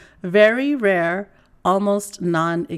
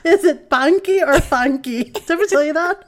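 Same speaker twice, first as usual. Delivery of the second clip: higher, louder Filipino mom impression; imitating mom's Filipino accent; 9 semitones higher, 6 dB louder than usual. Daughter retelling mom's question, in imitated Filipino accent. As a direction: mimic a puzzled Filipino mother's accent. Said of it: "my mom turns to me".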